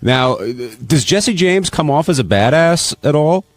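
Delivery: usual speaking voice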